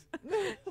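short high burst of laughter; laughing. Real, not acted. Host teased about ratings, bursting into high laughter. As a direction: giggle at being teased.

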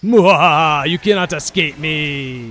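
slow, loud, high gloating villain; sneering panto villain. Pretend. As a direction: ham up the evil overlord. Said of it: "enough of that cringy bullshit".

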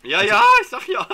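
very high loud yell; excited shout. Real, not acted. Snake confirmed.

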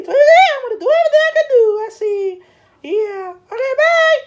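very high, loud, laughing hyper voice; high-pitched giggling party voice; squeaky excited character. Pretend. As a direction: bounce off the walls with glee.

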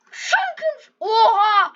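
high, laughing cries; laughing exclamations. Real, not acted. Gamer laughing at the chaos, laughing cries. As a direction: laugh through the words.

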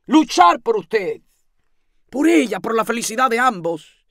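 high, loud urging; rousing exhortation. A narrator's reading. Landlady rousing the lodger to fight for love, fervently. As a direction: push each phrase out with fervour.

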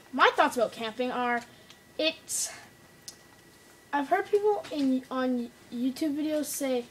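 normal talking voice

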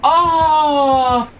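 high, frustrated scream; exasperated scream. Real, not acted. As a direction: let out a long high cry of frustration. Exasperated at game teammate.

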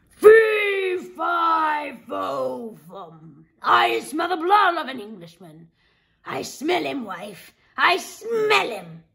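loud, high chant fading, then a slow, drawn-out "I smell him"; menacing giant sniffing out his prey; sniffing ogre. Acted. Ogre sniffs for the Englishman, menacing and slow.

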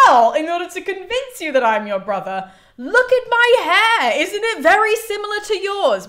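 an earnest brother, wildly theatrical; hammed-up Orestes. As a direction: Orestes pointing at his hair, high and hammy. Orestes voiced, hammed proof.

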